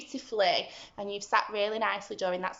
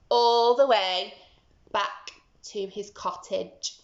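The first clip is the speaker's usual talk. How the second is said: ordinary delivery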